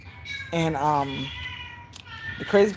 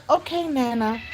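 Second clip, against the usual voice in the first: put-on obedient child; 8 semitones higher than usual. Calmed child agrees, retold.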